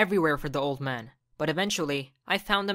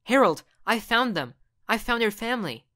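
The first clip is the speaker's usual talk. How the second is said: excited; 5 semitones higher, 4 dB louder than usual. Acted. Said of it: "I was so excited".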